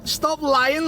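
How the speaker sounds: ordinary talk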